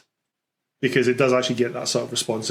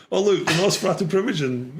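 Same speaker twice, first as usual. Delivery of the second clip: higher, mock game-player exclamation; protagonist sounding like himself; 6 semitones higher than usual. Host parodying his own game protagonists, mock-dramatic in his own voice. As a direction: announce it like a hammy player, in your own accent. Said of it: "every one of my protagonists sounds like me".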